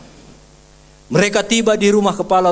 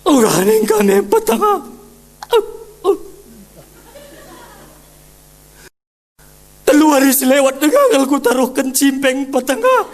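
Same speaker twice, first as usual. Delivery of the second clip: preacher imitating a mourner boasting at a funeral; mock mourner; 10 semitones higher, 4 dB louder than usual. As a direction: ham up a tearful boasting eulogy. Mocking a showy funeral mourner.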